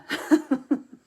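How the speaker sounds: short high burst of laughter; surprised laugh. Real, not acted. Laughing at her own story about Brian, short high laugh. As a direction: laugh at your own rant. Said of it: "where did that come from".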